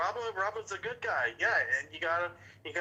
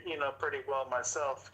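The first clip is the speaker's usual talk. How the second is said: ordinary talk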